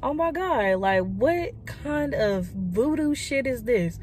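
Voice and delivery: mock horror, drawn out slowly; shocked disbelief. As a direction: act out how floored you were. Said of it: "I basically was like".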